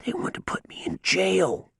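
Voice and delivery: unvoiced, frightened whisper. A narrator's reading. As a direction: breathe it out in terror. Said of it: "he whispered".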